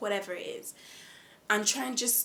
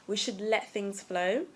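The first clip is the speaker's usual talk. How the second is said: normal talking voice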